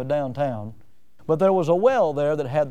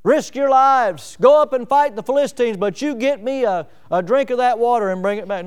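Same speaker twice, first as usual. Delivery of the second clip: louder, barked-out demand for water; a louder, imitated royal command to risk their lives; commanding order; 5 semitones higher, 4 dB louder than usual. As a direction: order the men out like a king. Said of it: "He didn't order a couple of his men".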